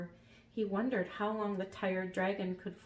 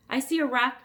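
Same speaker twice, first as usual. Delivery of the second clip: dragon voice; 6 semitones higher, 10 dB louder than usual. Acted. Dragon voice in story reading.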